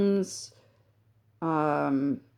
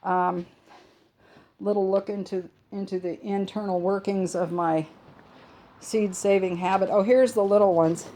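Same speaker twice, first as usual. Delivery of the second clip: ordinary talk, nothing marked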